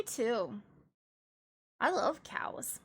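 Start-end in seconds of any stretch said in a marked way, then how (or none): 1.8-2.8 whispering. Real, not acted. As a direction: whisper it fondly. Cow love, whispered.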